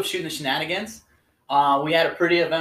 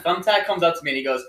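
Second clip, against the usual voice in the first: normal talking voice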